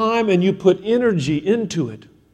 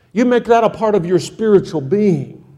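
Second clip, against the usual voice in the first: ordinary talk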